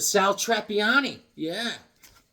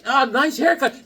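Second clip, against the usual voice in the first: laughing through a slow, higher compliment; teasing laugh; 7 semitones higher, 5 dB louder, slower than usual. Retold or imitated. Teasing Amir about his haircut, laughing and higher. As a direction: tease him with a laugh. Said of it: "I was like".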